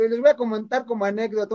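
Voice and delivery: normal talking voice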